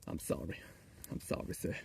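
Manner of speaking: soft, low whispered sorry; mock apology. Streamer apologising to a viewer, whispered mock sorry.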